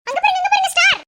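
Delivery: high, loud excited cry; excited calling out. Acted. Child shows the adult a star, excited calling out. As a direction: point it out with a delighted shout.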